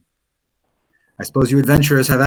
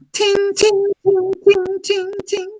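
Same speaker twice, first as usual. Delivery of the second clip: much higher, light "ting" taps; ping like a tiny hammer. Acted.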